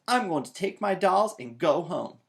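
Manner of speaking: loud, sulky declaration; huffy shout. A narrator's reading. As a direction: flounce off loudly. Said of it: "shouted Sister".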